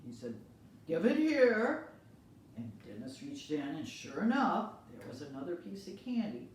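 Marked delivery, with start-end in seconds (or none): 0.9-1.9 high, loud, slow order; stern demand. Acted. Principal orders the boy to hand over candy, loud and slow.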